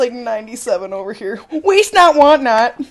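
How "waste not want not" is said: emphatic proverb. Real, not acted. Repeating phrase emphatically.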